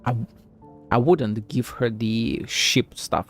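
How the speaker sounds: ordinary talk, nothing marked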